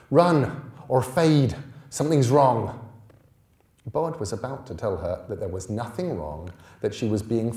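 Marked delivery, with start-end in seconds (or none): 0.1-2.8 slow, loud and higher urgent warning. A narrator's reading. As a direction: warn him to run, now.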